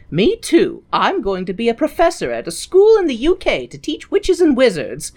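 mock proud announcement; McGonagall. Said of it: "McGonagall is like".

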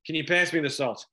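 Boston accent, words slurred into one. Shown as a demonstration. Actor demonstrating how Bostonians slur phrases, Boston accent. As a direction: run it all together in a Boston accent. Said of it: "in the Boston accent".

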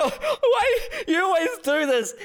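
very high, wailing mock protest; over-the-top high-pitched wail; hammed-up tearful yell. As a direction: put on a big crying show as a wronged teen. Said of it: "putting on the biggest show".

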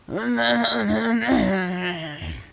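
drawn-out sleepy moan; sleep mumble. A narrator's reading. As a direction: mumble and groan as if fast asleep. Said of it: "said the guard in his sleep".

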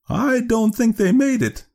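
very high, slow woman's character voice; Leora's official manner. Acted. Clerk doubting the parents found volunteers, high and slow. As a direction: pitch it right up for the woman clerk.

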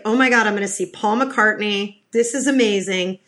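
ordinary talk, nothing marked

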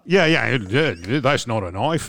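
mock Aussie movie-line delivery; Australian accent. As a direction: put on a broad Australian accent. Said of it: "I'm from down under".

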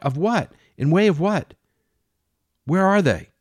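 captain yelling at Jane for the missing men; escalating fury. Acted. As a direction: build to a frantic yell.

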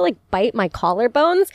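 ordinary talk, nothing marked

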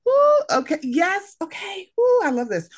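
high, thrilled ooh before reading; delighted gasp at the next topic; excited ooh. Real, not acted. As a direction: squeal it with glee. Reacting to a new statement.

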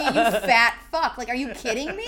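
loud, incredulous comic insult; hammed-up indignation; mock outrage. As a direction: drop the gush and hurl it loudly, half laughing. Sarcastic gush turns to insult.

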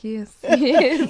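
laughing. Retold or imitated. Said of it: "And I was like".

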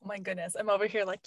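ordinary talk, nothing marked

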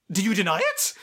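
lightly imitated haughty interrogator; mock Lady Catherine. Podcaster voices Lady Catherine challenging Elizabeth, mock imperious.

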